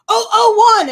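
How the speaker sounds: high, loud guard's yell; retold screaming. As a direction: mimic a guard screaming out a number. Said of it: "she starts screaming it".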